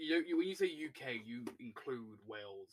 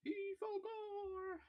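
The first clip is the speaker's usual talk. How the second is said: very high, very slow drawn-out mock; teasing squeak; much slower than usual. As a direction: stretch it out high to tease. Teasing repeat, stretched.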